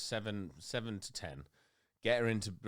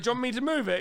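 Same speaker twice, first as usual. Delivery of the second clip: coaxing; 6 dB louder, faster than usual. Retold or imitated. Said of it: "I was like".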